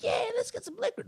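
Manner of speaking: put-on high girly voice, eager; high-pitched teen girl agreeing; girl's voice. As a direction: chirp it like an excited teen girl. Girl voiced in retold story.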